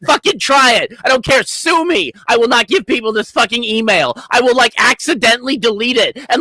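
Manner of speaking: high, heated rant; worked-up, high-pitched defiance; defiant rant. Acted. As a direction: rattle it off with rising, defiant exasperation. Defiant rant about refusing to comply.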